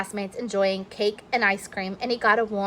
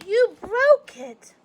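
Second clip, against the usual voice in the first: upset; 14 semitones higher, slower than usual. Acted. Joey upset.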